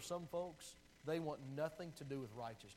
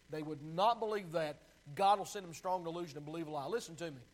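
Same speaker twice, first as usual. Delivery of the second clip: ordinary talk, nothing marked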